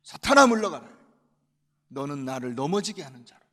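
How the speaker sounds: loud commanding "사탄아"; stern command. Acted. Jesus drives Satan away, loud stern command. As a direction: order Satan away with force.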